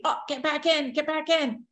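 high, urgent self-command; retold panicky urging. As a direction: urge yourself back in, pitched high. Urging herself back into body.

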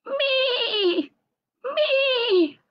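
very high bleating Me; sheep bleating. A narrator's reading. Lamb Lumi crying from the well, high bleat. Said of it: "she began to bleat louder".

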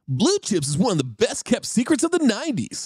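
high, hyped bro voice; bro reviewer voice. Pretend. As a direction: read it like an excited bro. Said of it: "I'll do the voice".

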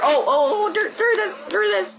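high, sudden oh there it is; excited discovery. Real, not acted. Gamer finally spotting the kill cam clip, surprised. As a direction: spot it and pounce with delight.

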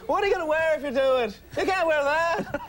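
high, incredulous comic questioning; hammed-up observational comedian voice; Jerry Seinfeld. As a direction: pitch it way up, querulous sitcom banter. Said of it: "Such a Seinfeldy bit".

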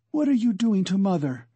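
plain reading voice

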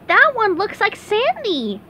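high, bright cartoon sponge voice; squeaky eager SpongeBob. SpongeBob voiced in read-aloud story.

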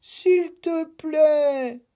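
high, slow drawn-out plea; stretched-out begging please; pleading. Acted. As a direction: beg him to open the box. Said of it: "supplia la Nuit".

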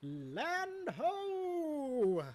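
high, ringing sailor's call; calling out land ho. Real, not acted. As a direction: call it from the crow's nest. Spotting the island.

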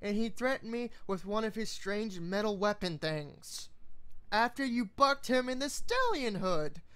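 high exasperated mare; exasperated Twilight voice. Acted. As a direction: snap at the bickering friends.

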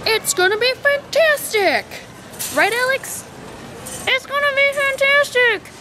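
salesman pitch. Acted. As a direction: sell the table like an infomercial. Mock salesman pitch at Costco.

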